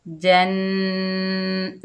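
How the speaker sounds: slow, drawn-out Quranic recitation. Shown as a demonstration. Teacher reciting the word for pupils, slow chanted recitation. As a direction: recite it slowly, like tilawat. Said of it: "एक अलिफ की मिकदार गुन्ना होता है".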